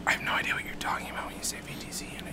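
fast whispered reply; whispering. Real, not acted. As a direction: whisper it quickly. Whispering back, quick.

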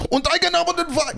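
high, barking German oratory; Hitler impression. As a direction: rant like a German dictator. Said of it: "A little more Eigen, a little less Albert".

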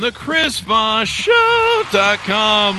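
high, slow sing-song show name; ringmaster. Acted. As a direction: stretch it out like a showman. Stretched high sing-song repeat.

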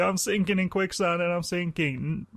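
high, hammy sinking damsel; mock peril. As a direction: whine it like a fake damsel sinking. Acting quicksand porn victim again.

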